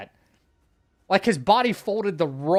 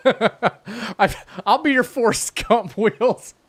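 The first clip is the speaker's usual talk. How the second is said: laughing; 6 semitones higher, 6 dB louder than usual. Real, not acted. Joking about Forrest Gump, laughing.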